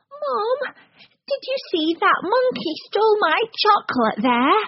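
put-on high child's voice, bewildered; a small boy tugging at mum, pitched high; startled little boy. A narrator's reading. Boy in queue telling mum about the monkey, high startled child voice.

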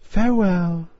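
a hint of a young girl parting; young mermaid. A narrator's reading. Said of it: "so she said".

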